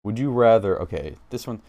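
normal talking voice

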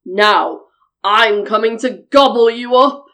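loud, low troll threat; big menacing troll. A narrator's reading. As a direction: boom it like a hungry troll. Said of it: "said the troll".

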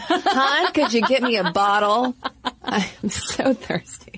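put-on playful baby talk, dragging at the end; wee baby. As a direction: lightly play a thirsty little kid through laughter. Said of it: "when you were but a wee baby".

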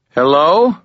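high, loud call into the empty cave. Acted. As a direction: call out to see if anyone is there. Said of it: "I called".

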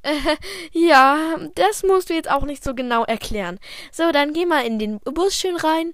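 put-on embarrassed teacher hurrying the kids along; flustered teacher. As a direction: brush off the kid quickly, half laughing.

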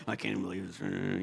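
low, soft mock muttering; demonstrating a private grumble; grumbling to oneself. Shown as a demonstration. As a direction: grumble under your breath. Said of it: "sometimes it's just to yourself".

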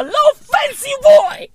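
very high, playful squeal; squealed boast. Acted. Comedian admitting he is used to luxury, high squealed boast.

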